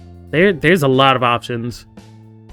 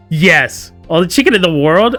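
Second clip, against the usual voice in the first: emphatic echoed retort; 4 semitones higher, 6 dB louder than usual. Acted. Voiced kids squabbling over chicken.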